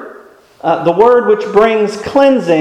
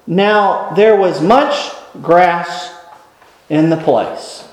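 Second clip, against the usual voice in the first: slow scripture reading; 2 semitones lower, slower than usual. Acted. Reading the text slowly.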